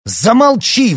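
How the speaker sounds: furious high-pitched shout; explosive yell to silence him; sudden bark. A narrator's reading. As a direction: bark it out at full voice. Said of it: "вдруг рявкнул Аркадий на отца".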